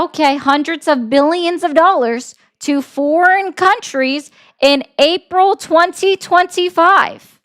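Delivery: emphatic, exasperated. Real, not acted. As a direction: press the question home, slowing on the date. Restating ignored question very pointedly.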